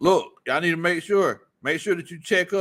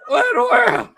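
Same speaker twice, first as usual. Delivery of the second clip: loud, high, laughing what in the world; laughing disbelief; 9 dB louder than usual. Real, not acted. Host stunned by a chat joke, loud high laughing disbelief. Host reacts to absurd comment.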